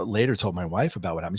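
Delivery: usual speaking voice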